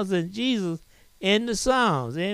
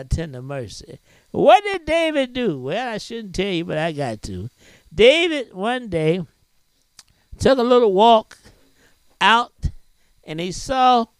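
raised, ringing preacher's voice building up; preaching; 6 semitones higher than usual. Acted. Preacher telling the congregation David's story, raised and ringing. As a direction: preach it high and full to the congregation.

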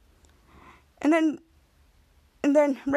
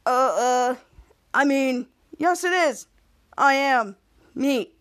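slightly lowered, halting disguise voice; hesitantly disguise your voice a little; put-on lower voice; slower than usual. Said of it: "does in the lower voice".

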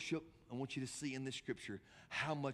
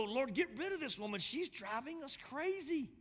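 high-pitched, fed-up whine of disciples; mock exasperation; exasperated complaint; 12 semitones higher than usual. Acted complaint of annoyed disciples.

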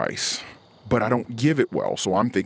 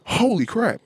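high-pitched holy crap; surprised exclamation; 13 semitones higher than usual. Real, not acted. Husband reacting to wife's claim with a high yelp. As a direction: yelp it out in disbelief.

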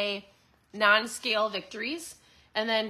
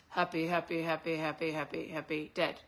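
imitating Ken Ford; 6 semitones lower, 7 dB quieter than usual. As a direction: lower and much softer, a lightly put-on voice of a calm scientist. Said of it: "Although he talks like this".

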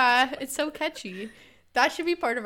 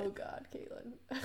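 soft exasperated whisper; 15 dB quieter than usual. Real, not acted. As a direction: whisper her name in disbelief. Friend reacts to joke.

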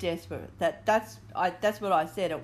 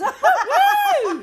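excited cheer; 11 dB louder than usual. Real, not acted. Cheering at double figures.

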